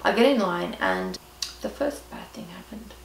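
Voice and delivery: ordinary talk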